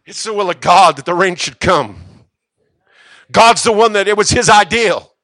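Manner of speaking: high, loud preaching climax; preaching at full force. Real, not acted. Preacher crying out that a travailing man brought rain.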